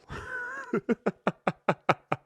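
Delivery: a burst of squeaky, high-pitched laughter; high squealing laugh. Real, not acted. Host cracking up at his own crude joke, squealing laugh.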